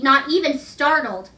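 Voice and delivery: plain reading voice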